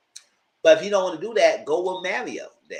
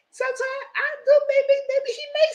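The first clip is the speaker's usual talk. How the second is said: much higher fast put-on voice; high mocking imitation, quick; squeaky mock voice; 3 dB louder than usual.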